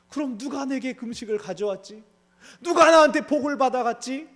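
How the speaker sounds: high, loud, shocked panic; old father shaken and loud with fear; trembling alarm. Acted. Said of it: "심히 크게 떨며".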